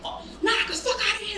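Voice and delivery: high, dismissive brush-off with a laugh; playful, un-corporate outburst; dropping the corporate act. Acted. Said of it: "sometimes I could be corporate".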